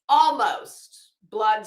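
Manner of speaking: slow, loud, drawn-out emphasis; hammed-up horror at the effort; mock dramatic. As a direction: stretch it out for theatrical effect. Dramatic pause over the hair.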